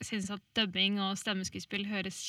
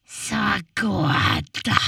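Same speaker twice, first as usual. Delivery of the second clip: troll voice; 8 dB louder than usual. Acted. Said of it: "sa trollet".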